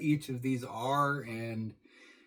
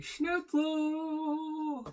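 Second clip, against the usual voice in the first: a much higher, exaggerated German cry of Schnitzel; German guy impression. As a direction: bellow it like a cartoon German character. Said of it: "the German guy".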